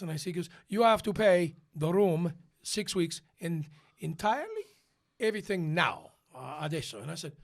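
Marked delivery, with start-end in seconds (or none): 0.7-7.0 put-on Italian accent. Hotel clerk demands payment from the tenor, emphatic Italian accent. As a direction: insist on payment in halting Italian-accented English.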